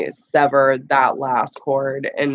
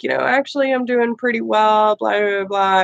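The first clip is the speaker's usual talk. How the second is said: breezy and carefree; 5 semitones higher than usual. Retold or imitated. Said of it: "I'm like".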